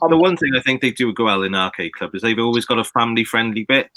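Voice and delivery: ordinary delivery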